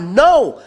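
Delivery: loud, high-pitched "no"; emphatic refusal. Real, not acted. Preacher rejecting any step on the words, emphatic refusal.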